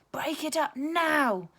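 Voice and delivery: slow, loud, high teacher shout; loud slow stern command. A narrator's reading. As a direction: bellow it to split up the crowd. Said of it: "shouted a voice".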